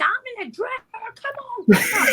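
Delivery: high-pitched hyped-up urging; hammed-up excited goading; egging on. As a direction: squeal it like a rowdy sidekick. Retells egging on in background.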